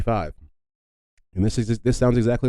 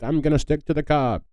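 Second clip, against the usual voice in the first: higher-pitched mock granddad; a hint of a stubborn grandpa, pitched up; imagined granddad; 7 semitones higher than usual. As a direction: lightly play a stubborn old granddad. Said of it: "imagine your granddad just being like".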